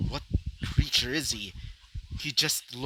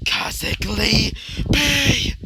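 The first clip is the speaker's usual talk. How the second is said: slow, loud, higher attack call; naming the attack; 7 semitones higher, 6 dB louder, much slower than usual. Acted. Vlad calling out his noble phantasm. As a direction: drag out the attack name grandly. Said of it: "He would say".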